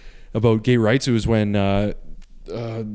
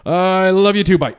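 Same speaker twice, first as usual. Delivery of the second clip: high, loud imitation of a panicked dad; flustered dad; 6 semitones higher, 6 dB louder than usual. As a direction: blurt it like a dad fleeing the call. Dad rushes reply and hangs up.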